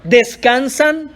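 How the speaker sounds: "¿Descansan?" as a slow disbelieving question; question tune. Shown as a demonstration. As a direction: stretch it out as a reproachful question. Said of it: "Signo de interrogación".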